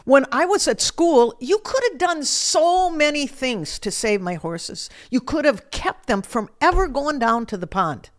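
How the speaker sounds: high, loud, anguished accusation; screaming at God. Retold or imitated. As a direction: yell the blame at God for the loss. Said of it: "I just kept screaming at him".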